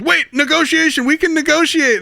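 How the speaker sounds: high, pleading backpedal; panicked envoy. Retold or imitated. The envoy begging Picard as he hangs up, high and frantic. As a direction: squeal it out in a sudden panic. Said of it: "the guy goes".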